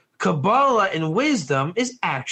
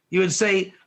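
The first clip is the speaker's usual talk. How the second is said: ordinary delivery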